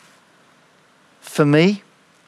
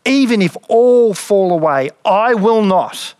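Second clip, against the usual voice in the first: firm and higher, slowing on I will not; emphatic declaration; 5 semitones higher, 3 dB louder, slower than usual. A narrator's reading. Peter declaring to Jesus he will never fall away, emphatic and firm. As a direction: Peter vows with full conviction. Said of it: "And Peter declares".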